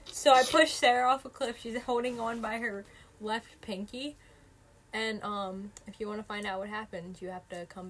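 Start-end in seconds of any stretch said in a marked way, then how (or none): 0.0-4.1 dramatic cliffhanger. Pretend. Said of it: "leave it on a cliffhanger".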